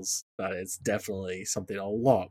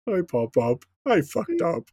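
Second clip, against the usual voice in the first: dopey big-guy confession, jumping higher on the last words; a big lug owning up to his grandpa; sheepish Grog confession; 7 semitones higher, 6 dB louder than usual. Retold or imitated. Host imitating Grog confessing to his Pop-Pop, sheepish and loud. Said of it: "and Grog just".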